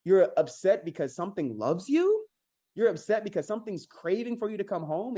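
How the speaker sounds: can't believe what you're hearing; incredulous. Retold or imitated. Answering a frustrated dog owner with loud, high disbelief. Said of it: "And I'm like".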